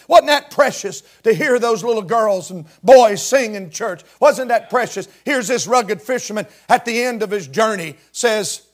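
ordinary talk, nothing marked

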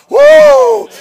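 high, loud joyful whoop; exultant whoop. Real, not acted. Preacher whooping at the congregation in joy. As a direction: let out a holler of joy.

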